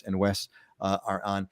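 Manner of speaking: normal talking voice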